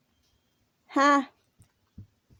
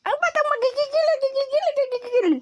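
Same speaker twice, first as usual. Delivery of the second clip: put-on very high sing-song baby voice; baby talk; faster than usual. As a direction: coo at the dog like a baby. (boses-baby na malambing)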